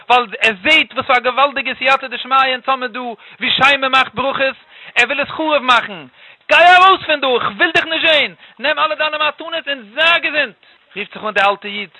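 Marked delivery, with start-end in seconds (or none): 6.5-10.6 raised, high order to get out; angry dismissal. Acted. Father banishes the old guest from his house, angrily.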